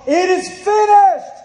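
high, loud and slow, every word held out; preacher's slow, full-voiced "It is finished"; ringing cry. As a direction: cry it out like the last words from the cross. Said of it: "screaming at the top of his lungs".

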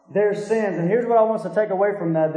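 usual speaking voice

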